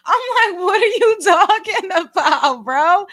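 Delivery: laughing through the words; can't hold the laugh. Real, not acted. Vlogger retelling coworker's claim, laughing.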